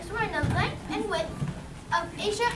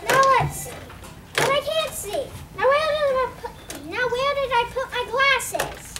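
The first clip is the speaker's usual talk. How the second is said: put-on higher, dithering old man; fussy old astrologer; 8 semitones higher, 3 dB louder than usual. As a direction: fumble about for the glasses. Astrologer cannot find his glasses.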